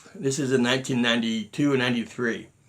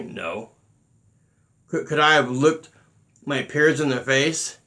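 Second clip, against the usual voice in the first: normal talking voice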